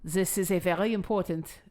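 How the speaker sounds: accidental mystery foreign accent; odd accent of no known country; unplaceable accent. Shown as a demonstration. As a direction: slip into an unidentifiable accent. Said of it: "I don't know what accent that was".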